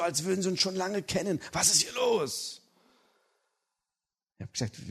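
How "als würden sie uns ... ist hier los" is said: puzzled brother. Retold or imitated. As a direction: wonder aloud, baffled.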